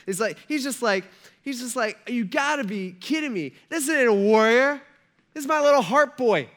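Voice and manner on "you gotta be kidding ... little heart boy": high, loud incredulous complaint; Saul. Retold or imitated. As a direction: protest in hammed-up dismay. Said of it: "the disappointment on Saul's face".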